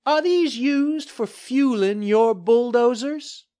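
high, slow, innocent-sounding question; cheery woman reporter. A narrator's reading. Reporter showing gas cans to the developer, cheery needling. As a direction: ask it slowly and loudly, feigning innocence.

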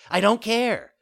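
high, loud puppet outburst; defiant child. A narrator's reading. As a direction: cut in loudly, bratty. Puppet defies again, quicker.

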